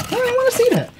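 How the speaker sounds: high squeaky voice. Pretend. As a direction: squeak it out, teasing. Repeats line in put-on voice.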